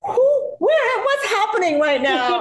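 very high, slow bewildered squeal; can't process it; dazed disbelief. Retold or imitated. Actress overwhelmed by Tracy's praise, squealing bewildered.

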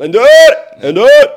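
high, full-voiced repeated shout; roepen door het huis. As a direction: yell it twice across the house. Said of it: "het is meestal wel gewoon". (luid en hoog roepen)

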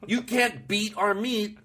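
slow, leering innuendo; mock innuendo. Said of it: "Why would you want to have".

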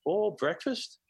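high, light imitation of His Holiness; high, welcoming mimic of the host. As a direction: lift the voice up to mimic a gentle host. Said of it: "He goes".

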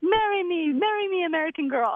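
high, hammed-up pleading "marry me"; mock proposal. Local kid proposing to the tourist, high and hammy. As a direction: lay it on thick, playful.

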